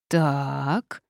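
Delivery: stretched, ominous "so..."; drawn-out word. A narrator's reading. Forest spirit has spotted the demon, long drawn-out word. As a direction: hold the vowel, sizing up trouble. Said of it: "протянул он".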